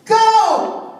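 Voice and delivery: high, loud shout of go; history as the race starter shouting go; starting shout. Acted. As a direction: fire off the start like a starting gun. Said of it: "the generation before said".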